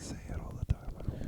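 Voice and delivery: soft unvoiced whisper. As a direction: whisper it close to the mic. Joining the mic whisper joke.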